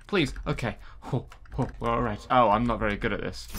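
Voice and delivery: ordinary delivery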